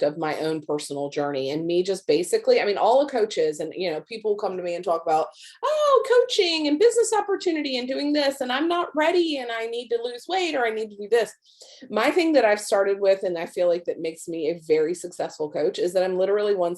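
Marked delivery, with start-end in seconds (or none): 5.6-11.3 imitated worried prospects. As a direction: imitate people gushing out their excuses in a high voice. Mimicking people's worries about coaching.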